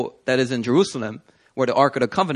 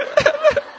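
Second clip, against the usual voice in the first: high laughing wail; 4 dB louder than usual. Retold or imitated. Retelling himself crying.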